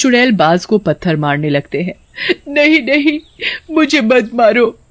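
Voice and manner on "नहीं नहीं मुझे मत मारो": high, pleading cries of a trapped creature; desperate pleading. Acted. Witch-hawk begging stoning villagers to spare her, high and desperate. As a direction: beg them to stop the stones.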